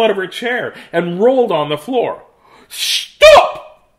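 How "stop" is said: very high, loud shrieked stop; teacher shrieking at the laughing girl, very high; teacher's shriek. Acted. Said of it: "said Missus Gomez".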